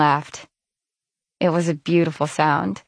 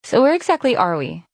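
casual question; 6 semitones higher than usual. Acted. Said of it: "she asked, shoveling more in".

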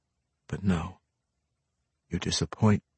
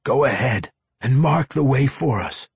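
raised, urgent instruction; higher, louder urging; Drizzt; 7 semitones higher, 7 dB louder than usual. A narrator's reading. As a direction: press him with a touch of desperation. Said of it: "voice raising in a bit of desperation".

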